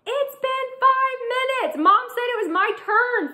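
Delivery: a little brother claiming his turn; high whining boy voice; kid screaming. Acted. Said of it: "Finn screams".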